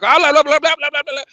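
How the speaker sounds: high, loud rapid gibberish; mock Cuban chatter; mocking fast Spanish. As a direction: rattle it off like a fast talker you can't follow. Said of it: "why do they talk Spanish like that".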